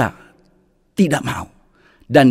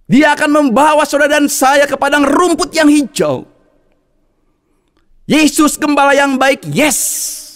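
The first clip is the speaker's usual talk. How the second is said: raised, high preaching voice; 8 semitones higher than usual. Acted. Preacher assuring the congregation, raised preaching voice. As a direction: proclaim it to the congregation. Preacher proclaims God's promises.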